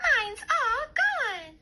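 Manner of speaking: very high, loud, slow cub cry; Baby Bear finding his porridge gone; Baby Bear wail. A narrator's reading. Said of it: "shouted Baby Bear".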